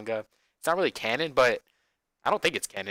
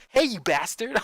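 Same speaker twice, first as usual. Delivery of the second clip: higher, louder mock greeting; imitating Leon; 11 semitones higher, 9 dB louder than usual. Streamer mimicking Leon's voice line, bright and higher. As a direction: toss out a cheery insult. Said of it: "Leon just goes".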